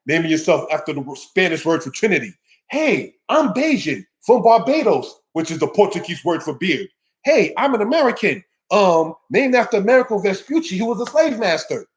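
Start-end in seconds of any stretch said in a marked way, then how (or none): 2.7-5.2 higher, slow mock announcement of a Bajan; mock proud. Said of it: "you will wake up saying". 7.2-11.1 higher, mocking proud claim. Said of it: "you will wake up saying".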